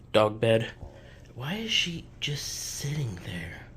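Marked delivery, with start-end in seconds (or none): 1.4-3.7 slow soft whisper to self; whispered aside. Real, not acted. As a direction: mutter it under your breath, puzzled. Aside about the dog.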